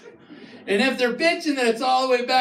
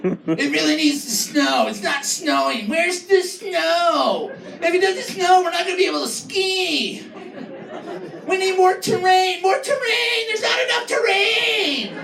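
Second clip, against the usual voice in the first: whiny local; 6 semitones higher than usual. Retold or imitated. Comic mocking locals who whine about snow. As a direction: whine like a spoiled skier. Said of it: "And we're like".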